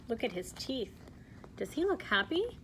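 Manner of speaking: ordinary delivery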